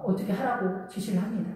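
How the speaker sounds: ordinary delivery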